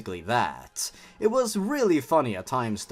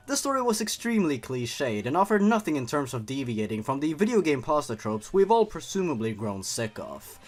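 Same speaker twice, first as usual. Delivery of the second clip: plain reading voice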